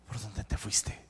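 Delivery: low, whispered aside; hushed whisper. Acted. Preacher voicing God to the congregation, hushed whisper. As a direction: drop it to a whisper. Preacher voices God's command, dropped voice.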